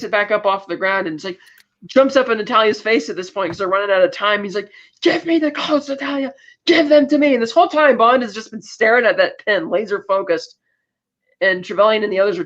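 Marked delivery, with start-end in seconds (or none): 5.0-7.3 high, strained impression of a villain; hammed-up high-pitched insistence; mock desperate demand. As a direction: demand it urgently in a put-on voice. Boris demands codes, time running out.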